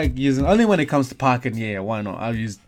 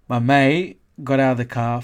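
ordinary delivery

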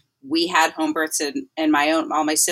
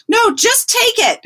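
loud, slow, higher cut-in; blunt comic retort; 7 dB louder than usual. Retold or imitated. Midwife friend refuses to share the pain, loud and slow. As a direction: shut down the noble offer flat. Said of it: "another woman said".

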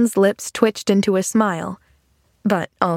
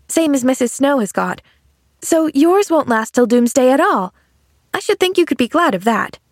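put-on lilting higher child's voice; cheerful little girl Pollyanna; 5 semitones higher than usual. Girl cheering a man with broken leg, chirpy. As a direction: explain it like an upbeat child.

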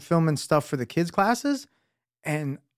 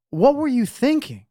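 retold scolding; 8 semitones higher than usual. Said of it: "I was cursing at him".

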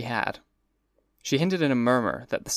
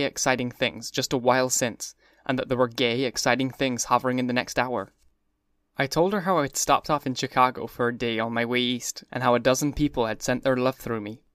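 ordinary delivery, nothing marked

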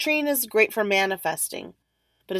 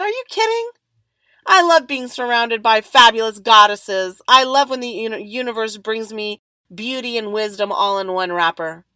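gushing enthusiasm; 2 semitones higher, 7 dB louder than usual. Retold or imitated. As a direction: exclaim it like you adore them. Gushing reply to the ladies.

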